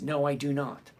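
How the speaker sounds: ordinary talk, nothing marked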